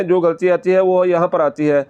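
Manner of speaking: ordinary delivery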